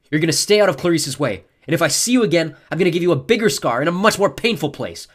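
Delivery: forceful angry ultimatum; stern threat. A narrator's reading. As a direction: lay down the law to a beaten enemy. Hero threatens the beaten fear god.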